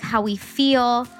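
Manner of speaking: ordinary delivery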